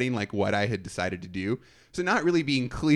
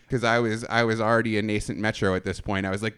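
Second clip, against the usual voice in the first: normal talking voice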